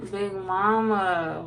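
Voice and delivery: reading a comment in a put-on Lotto voice; drawn-out, slow mock Lotto read. Reading a viewer's comment aloud in a Lotto voice. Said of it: "I just read that in Lotto voice".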